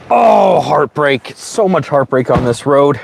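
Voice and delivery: high anguished oh, then lament; heartbroken cry. Real, not acted. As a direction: cry out the grief, then mourn. Mourning dead snake.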